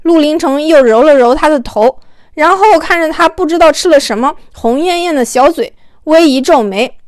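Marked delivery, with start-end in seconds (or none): none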